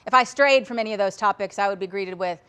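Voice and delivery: ordinary delivery, nothing marked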